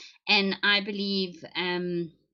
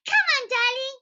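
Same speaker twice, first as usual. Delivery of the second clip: much higher, sugary cajoling mother; overbearing mom. Retold or imitated. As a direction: coax the child like a cloying parent. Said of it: "when parents are too overbearing".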